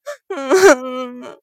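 drawn-out acted wailing sob; crying. A narrator's reading. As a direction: burst into a theatrical cry. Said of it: "哭着喊".

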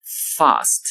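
"fast" with a long open back "ah", British style; British pronunciation. Shown as a demonstration. Teacher contrasting British "fast" with American, long back vowel. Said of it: "在英式英语中发作fast".

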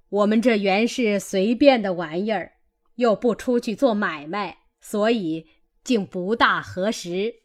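ordinary delivery, nothing marked

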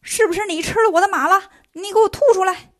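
loud, high demanding shout; furious yell. A narrator's reading. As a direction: bellow it, refusing to let go. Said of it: "孙悟空不依不饶".

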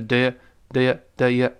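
"的也" reduced to a quick neutral schwa; weakened vowels. Shown as a demonstration. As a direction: glide over "的也" with weak vowels. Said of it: "的也一带而过".

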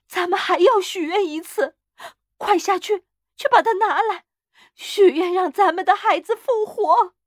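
high, loud, exultant urging; elated, frantic command; triumphant cry. A narrator's reading. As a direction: cry out the plan in feverish triumph. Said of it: "他得意地叫喊".

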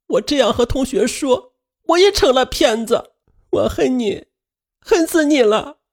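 put-on high, hurt child shouting; yelling. A narrator's reading. Daughter yelling at her mother, high hurt child. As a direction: hurl the accusations at mom. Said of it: "雪莉突然大叫".

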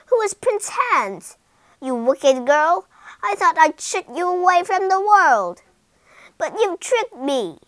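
loud, high scolding; furious witch. Acted. Witch raging at Rapunzel for her trick, loud and angry. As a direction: berate the girl who tricked her.

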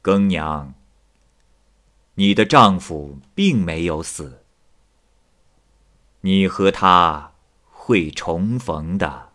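low, slow, drawn-out proclamation; mysterious calling voice. A narrator's reading. Unseen voice telling Gengniang of her husband, low slow intoning. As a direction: intone it slowly, like a voice reaching into a dream. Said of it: "有人大声地说话".

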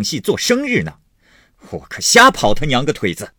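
scoff, then rail in bursts; angry yelling. Acted. Husband ranting about running errands for other people, angrily yelling. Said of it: "贾琏嚷道".

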